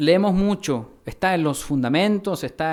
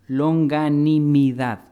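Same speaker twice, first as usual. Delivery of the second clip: Longanimidad said slowly and louder, each syllable set apart; revealing the key word; 5 dB louder, much slower than usual. Shown as a demonstration. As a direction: unveil Longanimidad slowly and firmly. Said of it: "tendría que haber sido". (Longanimidad, lenta y marcada, como revelación)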